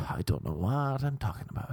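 usual speaking voice

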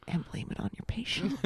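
hushed whisper for a naughty punchline; conspiratorial whisper. Real, not acted. Nurse shares a meme about blaming patients, mischievous whisper. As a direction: whisper it like a guilty secret.

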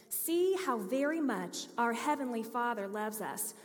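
ordinary talk

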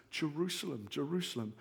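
hushed, mournful cry; lament. Acted. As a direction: grieve over the city. Jesus laments over Jerusalem.

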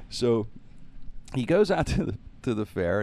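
usual speaking voice

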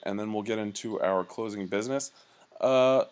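ordinary talk

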